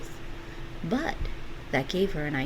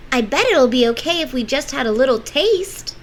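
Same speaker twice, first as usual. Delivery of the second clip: put-on young girl voice; 8 semitones higher, 8 dB louder than usual. Gretel voiced in story.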